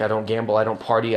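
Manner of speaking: ordinary talk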